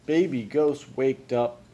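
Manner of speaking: ordinary delivery, nothing marked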